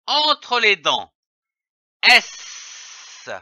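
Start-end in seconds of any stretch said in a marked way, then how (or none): 2.0-3.3 a drawn-out hiss between the teeth; sustained sss; long hissing S. Shown as a demonstration. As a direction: hiss the S through your teeth as long as you can. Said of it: "Faites siffler le son S le plus longtemps possible".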